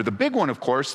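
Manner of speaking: ordinary delivery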